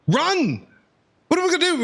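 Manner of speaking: usual speaking voice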